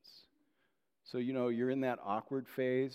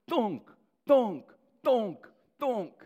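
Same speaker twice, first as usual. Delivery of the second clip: hammer thunks; 12 semitones higher, 10 dB louder, slower than usual. Retold or imitated. Imitating the dentist's hammer.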